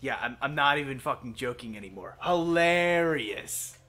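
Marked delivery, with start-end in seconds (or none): 2.2-3.8 loud, very drawn-out sarcasm; slow, heavy mock appreciation; sarcastic. Real, not acted. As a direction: stretch it out slowly, unamused. Deadpan reply to repeated Groot joke.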